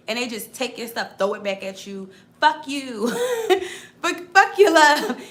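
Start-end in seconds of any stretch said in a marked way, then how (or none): 2.4-5.2 loud, higher, laughing mock troll snarl; rude troll. The troll throwing your gifts back, loud laughing mock snarl. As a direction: spit it back at them through a laugh.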